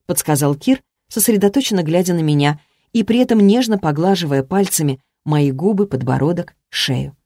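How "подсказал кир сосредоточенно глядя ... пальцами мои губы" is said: ordinary delivery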